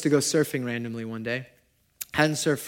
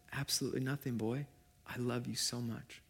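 very soft, gentle and hushed; tender voice of God; 13 dB quieter than usual. Retold or imitated. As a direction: speak like a loving father, almost under the breath. God answers him tenderly.

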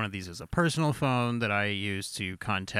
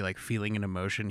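ordinary talk

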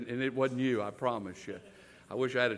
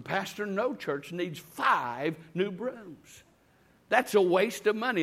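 higher-pitched, mildly indignant complaint; mock irritated; 6 semitones higher, slower than usual. Church member complaining to the pastor about brooms, mildly irritated. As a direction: build the complaint, dragging out the key words. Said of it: "kept getting more irritated and irritated".

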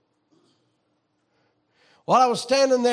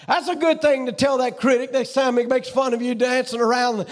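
usual speaking voice

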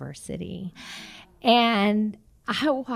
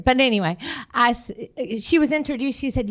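usual speaking voice

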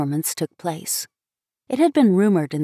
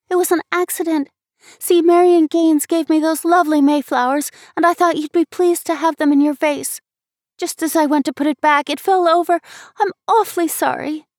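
put-on high-pitched, pleading girlish apology; contrite little girl; 9 semitones higher, 5 dB louder than usual. A narrator's reading. Sister apologizing to her brother for the broken vase, contrite. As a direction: explain earnestly in a high child's voice. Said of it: "she stammered contritely".

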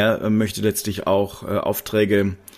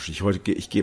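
ordinary talk, nothing marked

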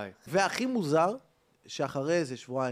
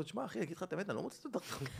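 sheepish confession; 3 semitones higher, 11 dB quieter, faster than usual. Retold or imitated. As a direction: admit it meekly to calm him down. Sheepish reply to angry man.

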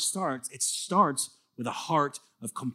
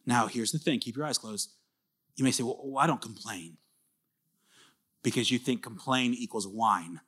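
quiet reflection prompt; 5 semitones lower, 4 dB quieter than usual. Acted. Asks them to close their eyes.

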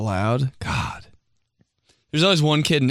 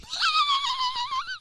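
shrieking laugh; 5 dB quieter than usual. Real, not acted. Cracking up at own joke.

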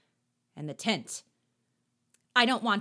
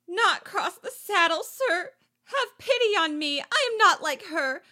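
pleading; 9 semitones higher, 7 dB louder than usual. Acted. Sylvette pleads, panicking.

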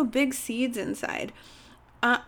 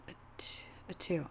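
guilty whisper; 5 semitones lower, 14 dB quieter than usual. Real, not acted. Guilty low score.

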